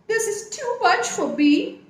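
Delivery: put-on elephant's child with his nose caught by the crocodile, nasal; through his nose. A narrator's reading. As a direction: talk with your nose held shut. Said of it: "he said through his nose".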